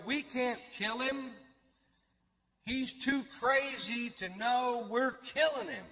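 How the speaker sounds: drawn-out voice of the officials; retold quote. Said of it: "they go".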